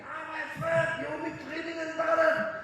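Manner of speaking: high, loud far-off yell of two guys; mock shouting. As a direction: shout it like two men calling across the room. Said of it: "j'entends ces deux qui crient". (cri moqueur aigu et fort)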